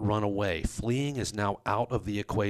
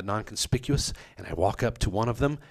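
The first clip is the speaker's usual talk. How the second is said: ordinary talk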